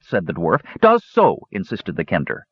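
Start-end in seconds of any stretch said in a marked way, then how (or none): 0.8-1.5 put-on high, childish retort. A narrator's reading. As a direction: bicker like a child.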